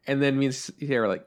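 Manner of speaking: ordinary delivery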